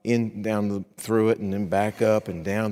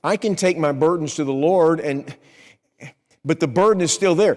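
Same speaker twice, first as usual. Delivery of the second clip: protesting objection; 6 semitones higher, 5 dB louder than usual. Acted. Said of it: "You say".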